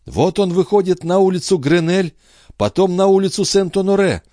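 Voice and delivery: put-on high, urgent tracking of the boy; mother tracing her son's route, high and urgent; entranced seer. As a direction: follow him street by street, urgent.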